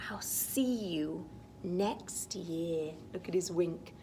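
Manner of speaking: ordinary delivery, nothing marked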